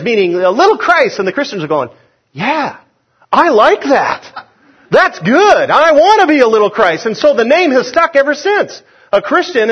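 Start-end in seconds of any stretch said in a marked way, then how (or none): 2.3-7.0 high-pitched, enthusiastic embrace; acted delighted approval. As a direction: warm to the nickname with eager relish. Christians gladly embrace the nickname.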